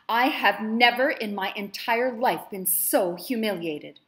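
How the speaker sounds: loud, slow, seething outrage; furious mistress berating her slave; humiliated fury. Acted. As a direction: spit out every word of the insult. Madam rages at humiliation.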